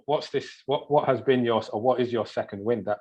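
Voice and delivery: ordinary delivery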